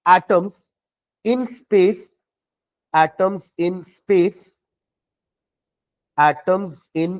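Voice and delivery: very slow, measured dictation; word by word, pausing for writers; dictating. Real, not acted. Teacher dictates a definition for students to write, slowly and deliberately. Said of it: "चलो definition लिखना".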